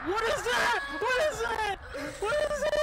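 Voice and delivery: high loud alarmed cries; alarmed shouting. Real, not acted. Sees something scary.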